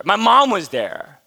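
ordinary delivery

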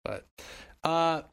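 usual speaking voice

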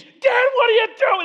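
very high, loud mock wail; hammed-up hysterical child; crying, screaming kid. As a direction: imitate a child shrieking at Dad. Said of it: "They're crying, screaming again".

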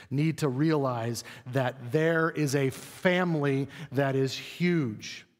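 normal talking voice

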